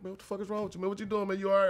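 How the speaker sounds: pitched well up, pleading with him; high concerned plea. Retold or imitated. To seven-foot teammate staring at her, high worried call.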